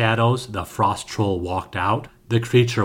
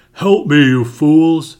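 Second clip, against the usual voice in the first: loud, furious troll yell; 5 semitones higher, 9 dB louder, slower than usual. A narrator's reading. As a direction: bellow for help at them. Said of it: "the troll yelled at the Draugr".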